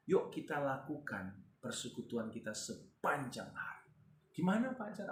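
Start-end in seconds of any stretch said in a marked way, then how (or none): 4.3-5.1 much higher, lively appeal; animated call-out. Real, not acted. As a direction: call to a listener by name. Preacher calls out to congregant.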